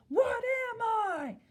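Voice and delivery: high, drawn-out yell of "What am I"; yelled exclamation. Shown as a demonstration. Teacher showing pupils the wrong reading, exclaimed yell. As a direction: yell it at someone like it ends in an exclamation mark. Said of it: "exclamation mark and yell it at someone".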